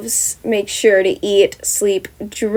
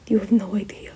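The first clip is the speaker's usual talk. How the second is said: unvoiced, secretive whisper; 7 dB quieter than usual. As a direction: breathe it with no voice.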